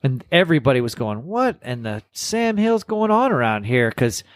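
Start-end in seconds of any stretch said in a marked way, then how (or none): 1.3-3.9 high, slow mock grumble; good-natured complaining. People waiting in a long line, playfully complaining. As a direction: imitate baffled folks griping, pitched up and drawn out. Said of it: "they just kept complaining".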